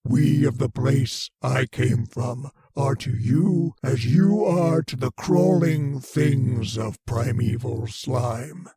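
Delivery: ordinary talk, nothing marked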